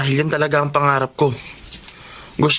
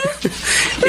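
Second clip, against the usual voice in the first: short laugh. Acted. Brother laughs at greeting.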